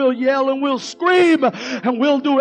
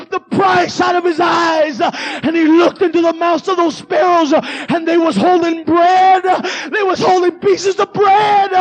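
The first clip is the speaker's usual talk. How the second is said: ordinary talk, nothing marked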